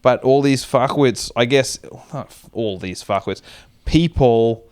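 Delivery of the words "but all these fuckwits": ordinary talk, nothing marked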